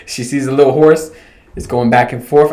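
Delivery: ordinary delivery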